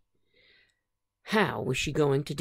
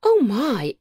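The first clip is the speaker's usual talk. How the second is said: raised, surprised gasp of words; exclamation; 7 semitones higher, 6 dB louder than usual. Acted. Aunt Sassy exclaiming at the snowfall, surprised. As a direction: exclaim it, startled and higher. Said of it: "exclaimed Aunt Sa-, Sassy".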